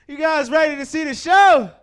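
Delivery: loud, high crowd hype; big emcee call to the crowd; hype shout. Real, not acted. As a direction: pump up the room. Host hyping the crowd.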